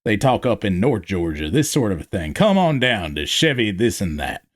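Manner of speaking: country North Georgia twang; North Georgia accent. Shown as a demonstration. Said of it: "Most folk talk like this".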